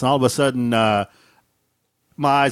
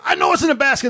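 irritated; 10 semitones higher than usual. Retold or imitated. Snapping back at wife.

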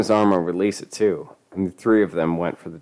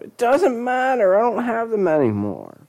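whiny protest. A narrator's reading. Cornered boy dodges drug questions, high whiny wail.

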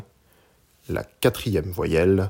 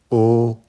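O held high and perfectly level; first tone on O; 3 semitones higher, 7 dB louder than usual. Shown as a demonstration. Teacher showing learners Mandarin O, high level tone. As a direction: say O loud, long, high and even. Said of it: "premier ton".

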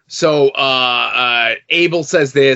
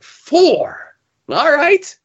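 mock surprise; 14 semitones higher, much slower than usual. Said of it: "I'm like".

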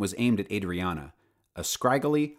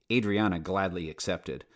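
plain reading voice

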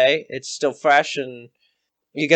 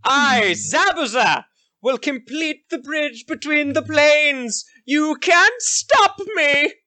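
much higher, slow gloating villain; Zabuza villain voice; 11 semitones higher than usual. Acted. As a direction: gloat like a mad sorcerer. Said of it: "You see Zabuza".

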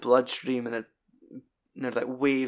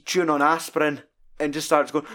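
ordinary talk

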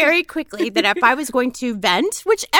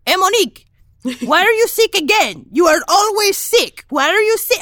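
higher, louder scolding father; nagging dad; 8 semitones higher, 4 dB louder than usual. Retold or imitated. Dad on the phone scolding Monique, loud and high. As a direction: nag her like a worried dad. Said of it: "my dad will call and be like".

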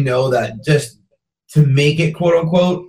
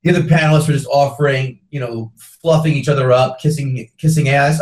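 normal talking voice

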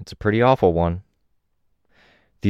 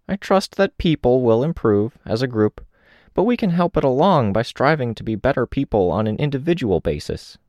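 put-on gently raised older woman's voice; a lightly voiced reflective old woman; 7 semitones higher than usual. Old woman speaking in story.

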